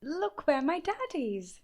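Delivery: proud, thrilled, pointing it out; excited. A narrator's reading. Boy shows the bear his dad's postcard, excited. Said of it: "cried Danny".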